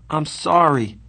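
apologize, sorry for yourself; mildly miserable; miserable apology. A narrator's reading. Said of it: "I said miserably".

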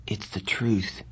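whispery, resigned remark; hushed, bleak murmur; bleak grin. A narrator's reading. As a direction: say it softly through a bleak grin. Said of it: "said with a bleak grin".

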